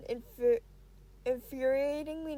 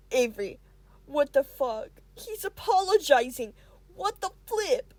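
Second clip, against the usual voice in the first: inner panic; 8 dB louder than usual. Acted. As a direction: freak out at the apology. Avery panics at the apology.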